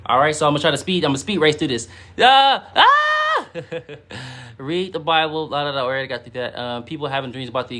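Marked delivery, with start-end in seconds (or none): 2.2-2.7 sudden high yelp of excitement; excited yelp. Real, not acted. Streamer yelps before speeding through comments. 2.7-3.5 very high squealing yelp. Real, not acted. Streamer squeals excitedly while rushing through comments. Second yelp, much higher.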